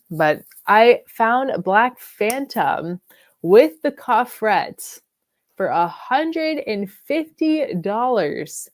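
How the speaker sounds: slow, drawn-out deal reveal; slow emphatic. Real, not acted. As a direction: stretch each word to sell it. Bragging about the deal.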